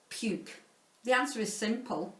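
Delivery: plain reading voice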